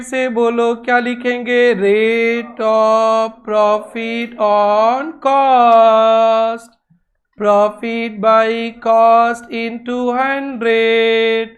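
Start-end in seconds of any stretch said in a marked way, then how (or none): 1.7-11.5 drawn-out, word by word; slow classroom dictation; slow formula dictation. Shown as a demonstration. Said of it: "teacher dictates formula".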